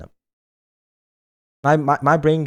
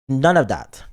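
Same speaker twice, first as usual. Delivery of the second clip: louder none of that; louder playful scold; mock scold; 4 dB louder, slower than usual. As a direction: snap it out in fun. Mock scold, loud take.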